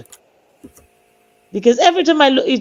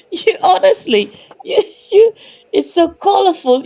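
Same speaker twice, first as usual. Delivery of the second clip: laughing; 7 semitones higher than usual. Real, not acted. Laughing while teasing.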